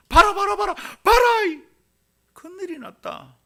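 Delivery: very high shouted call, dropping in volume at the end; acted urgent alarm. Someone breaking astonishing news, acted urgent alarm. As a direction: yell for everyone to look. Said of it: "너무 놀라운 소식을 전할 때".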